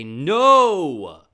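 long, high, very loud "No"; defiant shout. Retold or imitated. Child refusing dad's pronunciation, defiant shout. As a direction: belt out a drawn-out refusal.

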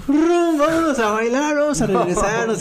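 put-on very high, loud party-girl whine; drunk friend. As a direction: play the wasted friend begging to go out. Drunk friend wants to go dancing. (amiga borracha con voz muy aguda)

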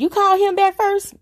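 high, loud incredulous question; imitated disbelief. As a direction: mimic the family's astonished outcry. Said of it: "that's what they all said".

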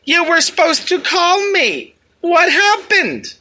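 high, slow, drawn-out mock whine; whiny complaining girl. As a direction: draw it out like a needy girlfriend. Imitates girl texting her complaint.

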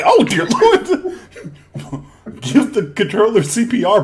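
high, loud and laughing; hammed-up panic over the broken controller; pleading for a broken controller, high and laughing; mock alarm. Acted reaction to a broken controller.

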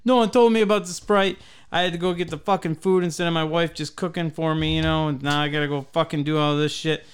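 high, heated rant; re-enacted worked-up grievance; retold rage. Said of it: "I'm getting into my rage".

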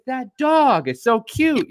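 high, drawn-out mock cooing; cooing wife. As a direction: imitate the wife gushing slowly over a puppy. Wife cooing over dog, retold.

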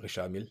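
Richard Mille as ree-SHAR meel; French "sh" and soft R in Richard; French pronunciation. Shown as a demonstration. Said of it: "over there you'd pronounce it".

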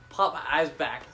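ordinary delivery

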